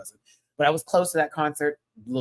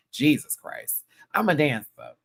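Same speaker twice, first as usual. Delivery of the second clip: ordinary talk, nothing marked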